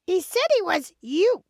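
high, protesting sheep voice; upset sheep. Acted. Sheep reports the wolf to her husband, upset.

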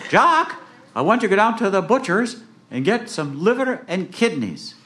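high-pitched mother giving an errand; Jack's mother. Acted. Mother sending her son to the butcher, high mum voice. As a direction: call out high as a storybook mum.

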